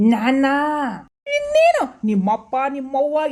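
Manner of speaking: loud, high ನಾನಾ? in outrage; indignant shout. Acted. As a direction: throw ನಾನಾ? back as an outraged question. Questioned about identity. (ಕೋಪದಿಂದ ಜೋರಾಗಿ ನಾನಾ? ಎಂದು ಕೇಳುವುದು)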